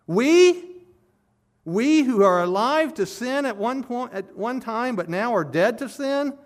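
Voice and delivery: high, emphatic rhetorical question starting on a loud "We"; incredulous. Acted. Preacher voicing Paul's question about continuing in sin, incredulous. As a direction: ask it as utterly unthinkable.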